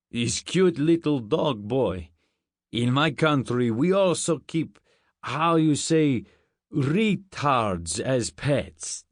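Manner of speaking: put-on slow, heavy Russian-accented guard; thick Russian accent. A narrator's reading. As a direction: talk slowly and haltingly in a thick Russian accent. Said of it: "He had a thick Russian accent".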